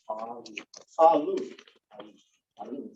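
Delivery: ordinary delivery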